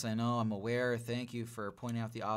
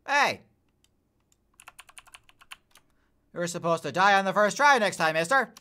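loud, high taunting yell; shouting; 8 semitones higher, 12 dB louder than usual. Real, not acted. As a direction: yell it at the enemy. Yelling at enemy in game.

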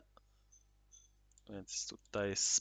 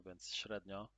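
ordinary talk

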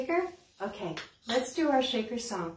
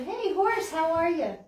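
higher, bright hello to a horse; excited greeting; 6 semitones higher, 4 dB louder than usual. Acted. Librarian excitedly greets the toy horse.

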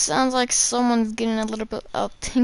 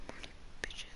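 breathy whisper of the name, no voice; 22 dB quieter, much faster than usual. Shown as a demonstration. Gamer whispering a name to himself mid-game. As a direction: hush it to himself like a secret.

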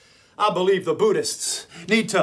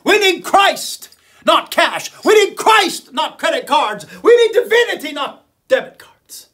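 rising, louder pulpit preaching; fiery preaching; 4 semitones higher, 4 dB louder than usual. Acted. Preacher calling out the president, full pulpit force. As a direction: preach it hard to the congregation.

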